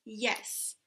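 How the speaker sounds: usual speaking voice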